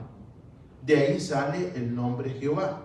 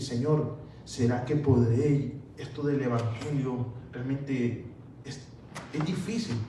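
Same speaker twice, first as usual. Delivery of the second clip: a hint of a low, softer doubting believer; 4 semitones lower, 4 dB quieter than usual. A believer asks God if he can manage, lightly voiced, lower and softer.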